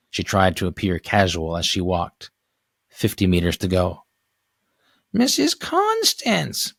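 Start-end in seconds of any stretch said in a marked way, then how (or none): 5.1-6.7 put-on high, drawn-out old woman calling out; high calling neighbor. Neighbor calling out to Missus Constance, high and drawn out. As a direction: hail her from the front steps in a high old lady voice.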